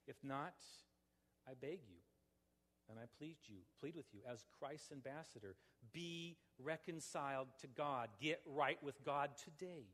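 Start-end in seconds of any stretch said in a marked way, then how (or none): none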